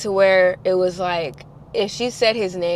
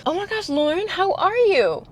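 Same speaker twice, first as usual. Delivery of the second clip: lightly raised, high mock-cheerful greeting; fake surprised greeting; 11 semitones higher than usual. Crush pretending to be glad to see Lauren, mild high mock cheer. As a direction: act innocently surprised.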